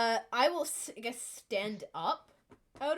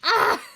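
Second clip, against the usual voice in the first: startled scream; 10 semitones higher, 15 dB louder than usual. Real, not acted. Bad roll, sudden outburst.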